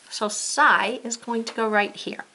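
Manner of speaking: normal talking voice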